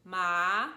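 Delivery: "ma" drawn out and rising like a question; questioning glide up on "ma"; second tone. Shown as a demonstration. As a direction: slide "ma" upward, loudly. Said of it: "The second ma we practiced".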